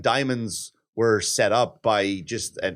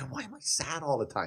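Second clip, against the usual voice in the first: much softer, small inner question; quiet childhood question; 3 semitones higher, 9 dB quieter than usual. Retold or imitated. Remembering his childhood sadness, a soft inner question. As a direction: murmur it to yourself like a sad kid.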